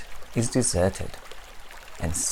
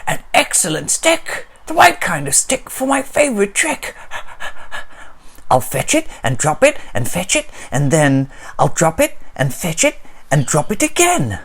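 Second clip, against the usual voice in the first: high, loud, excited dog voice; gleeful dog; eager dog; 8 semitones higher, 5 dB louder than usual. Acted. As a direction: bounce through it like a playful dog. Dog character speaks.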